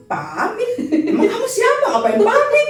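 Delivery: high, teasing comeback through a laugh; sassy retort. Retold or imitated. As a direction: brush him off cheekily, half laughing. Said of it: "Gue bilang gitu". (balasan ketus sambil tertawa)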